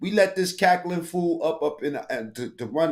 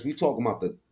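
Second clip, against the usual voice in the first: normal talking voice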